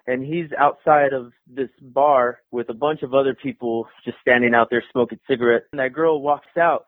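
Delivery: ordinary talk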